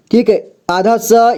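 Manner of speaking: ordinary delivery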